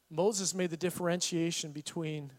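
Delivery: ordinary talk, nothing marked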